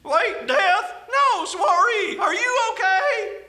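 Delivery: high, frantic newcomer; panicked slug voice. Acted. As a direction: cry out in panic.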